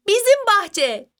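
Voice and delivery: very high, drawn-out excited cry; shouted answer. A narrator's reading. Two children shouting the last answer together, excitedly. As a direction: call it out slowly and high, in delight. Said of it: "diye bağırdılar aynı anda".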